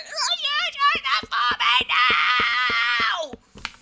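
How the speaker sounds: very high, loud, slow exasperated yell; yelling at kids. Retold or imitated. Mother yelling at her kids to do the chore, loud and high. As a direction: scream it like the fifth time. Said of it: "when I yell at them".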